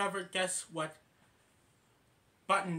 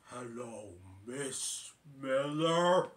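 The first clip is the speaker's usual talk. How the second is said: lower, slow, dragged-out message; garbled recording; 3 semitones lower, much slower than usual. Retold or imitated. Wrong button playing a distorted message to Miss Miller, low slow. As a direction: drag it out low like a slowed tape.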